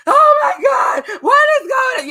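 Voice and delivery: high, loud, slowed screaming imitation; mock scream. Teasing husband about his shriek, hammed-up high scream. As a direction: ham up his panicked shriek. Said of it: "You was screaming and all of that".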